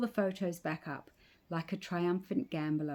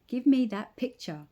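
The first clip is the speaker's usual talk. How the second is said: higher and louder sharp demand; snapping; 6 semitones higher, 6 dB louder than usual. A narrator's reading. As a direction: snap it at him. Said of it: "I would snap".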